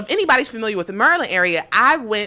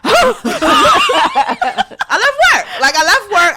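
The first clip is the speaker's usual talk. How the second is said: high laughing cry of disbelief; laughing, loud, high; 14 semitones higher, 8 dB louder, much slower than usual. Real, not acted. Telling friends she left work, loud and laughing. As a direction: belt it out through laughter. Retelling leaving work in anger.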